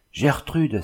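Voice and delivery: mild exclamation. A narrator's reading. Xavier sees Gertrude appear at the workshop door, mild exclamation. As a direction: call out her name, lightly surprised. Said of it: "s'écria Xavier".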